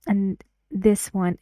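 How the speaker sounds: ordinary talk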